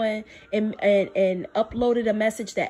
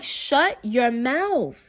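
slow firm command; much slower than usual. Retold or imitated. God's firm rebuke quoted.

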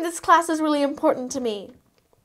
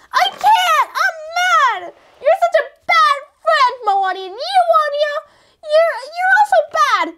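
petulant shout; 8 semitones higher than usual. Acted. Cindy refuses to get up.

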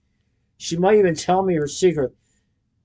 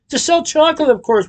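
much higher, louder eager pitch; excited; 12 semitones higher, 4 dB louder than usual. A narrator's reading. Willie eagerly pitching his plan to Noodle. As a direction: sell the plan brightly.

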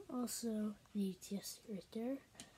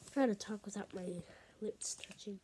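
normal talking voice

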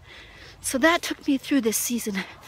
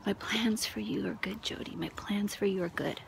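hushed, softer repeated promise; soft whisper; 7 dB quieter than usual. Retold or imitated. God waking Jody each morning, softly whispered.

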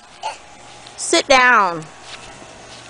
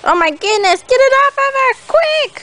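high-pitched mock urgency, climbing higher; playful alarm; 14 semitones higher than usual. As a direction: rise into an urgent, high play panic. Play alarm over cricket on Zoe.

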